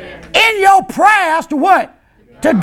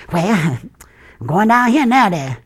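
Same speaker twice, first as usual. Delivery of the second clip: lower, laughing voice of a member who keeps switching churches; church hopper; 11 semitones lower than usual. Retold or imitated. Member caught church-hopping laughs off the question, in a lower chuckling voice.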